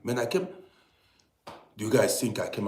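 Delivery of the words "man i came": ordinary talk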